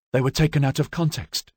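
ordinary delivery, nothing marked

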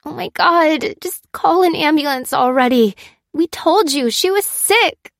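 high, loud angry protest; loud, exasperated outcry, pitched well up; frantic cry. A narrator's reading. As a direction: cry out at a useless official. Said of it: "cried Fliss, accosting the clerk".

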